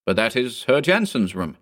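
plain reading voice

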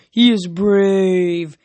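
slow, loud, drawn-out Cyclops voice; ponderous Cyclops praise; rumbling Cyclops. Acted. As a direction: stretch the words out like a big Cyclops. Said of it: "one rumbled".

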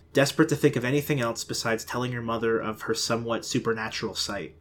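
ordinary delivery

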